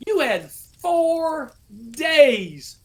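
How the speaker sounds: high, loud and slow, days hit hard; incredulous scolding. Retold or imitated. First sergeant scolding a soldier with muddy boots, loud. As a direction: drag out the four days in disbelief.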